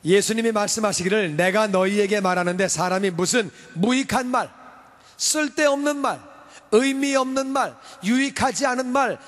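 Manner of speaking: fiery sermon delivery; full-force preaching. Acted. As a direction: proclaim it from the pulpit.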